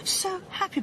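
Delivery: overjoyed insistence. Acted. Wife telling worried husband she's fine, happy. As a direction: reassure him, voice lifted with happiness. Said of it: "I'm happy".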